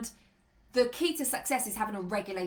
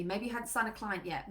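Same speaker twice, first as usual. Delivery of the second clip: normal talking voice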